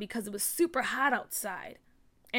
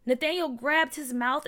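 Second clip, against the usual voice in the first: plain reading voice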